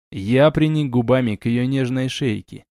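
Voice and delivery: ordinary delivery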